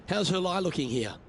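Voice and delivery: ordinary delivery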